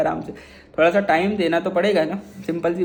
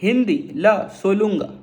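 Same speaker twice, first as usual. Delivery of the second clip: slow Tamil demo. Shown as a demonstration. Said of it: "तो कैसे बोलेंगे?".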